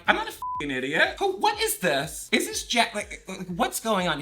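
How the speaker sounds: mock outrage, voice jumping high; retold indignation. Confronting suspected scam caller, replayed indignantly.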